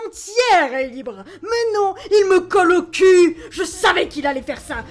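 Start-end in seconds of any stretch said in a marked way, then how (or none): 1.4-3.4 high, loud complaint; pitched-up indignant yell; exasperated whine. Acted. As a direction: wail it in road rage. Ranting at tailgater.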